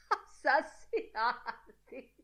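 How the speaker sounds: high, slow, laugh-broken word. A narrator's reading. Mulligan laughing at his own murder warning, high cackling laughter. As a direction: burst out laughing through the word.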